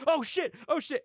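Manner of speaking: high, loud alarmed cry; mock panic. Crew outside faking gunfire, high panicked yelling. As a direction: cry out as if shots are fired. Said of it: "all the crew outside like".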